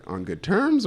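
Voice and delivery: high, worried little question; anxious question. Retold or imitated. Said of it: "And I was like".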